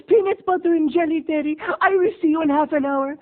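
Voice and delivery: hammed-up prim mom, high and loud; mother's invitation. Neighbor mom inviting Terry to lunch, high and loud. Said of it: "What's for lunch?".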